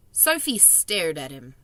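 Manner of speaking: ordinary delivery, nothing marked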